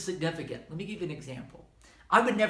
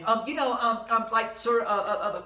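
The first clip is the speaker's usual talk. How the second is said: louder, higher string of ums and uhs; filler-ridden stammering; 6 semitones higher, 6 dB louder than usual. Shown as a demonstration. Speaker showing students false starts not worth transcribing, filler-ridden stammering. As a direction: stumble through filler words, getting nowhere. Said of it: "if you're just going".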